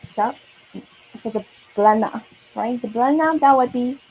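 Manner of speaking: ordinary talk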